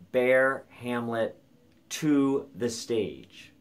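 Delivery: slow, weighty reading of the verse line; Shakespeare recited slowly, with gravity; slow dramatic recitation. Shown as a demonstration. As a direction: let each word of "Bear Hamlet to the stage" land slowly, like verse on a stage. Teacher recites the Fortinbras line.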